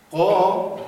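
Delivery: قا held loud with the mouth opened vertically; open Arabic vowel. Shown as a demonstration. Teacher shows the vertical mouth shape, loud قا. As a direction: project قا with a tall open mouth.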